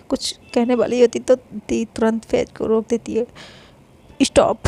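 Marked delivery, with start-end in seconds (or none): 4.2-4.6 loud commanding stop; sharp stop. Acted. Sister stops Faiz from interrupting, loudly. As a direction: cut him off hard.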